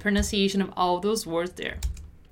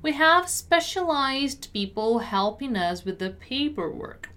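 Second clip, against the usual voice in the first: careful word-by-word teaching read; measured pronunciation model; 3 semitones higher than usual. Shown as a demonstration. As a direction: articulate each word unhurriedly. Teacher models sentence slowly first.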